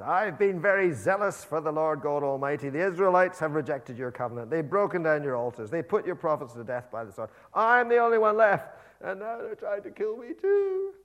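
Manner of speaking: loud impassioned rant; major rant. Acted. Elijah rants to God in loud complaint. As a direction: pour it all out at the top of your voice. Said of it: "Elijah has this major rant".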